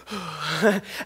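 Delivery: sharp startled gasp, soft and breathy; a quick intake of breath like a kid spotting candy. Retold or imitated. Child spots candy at checkout.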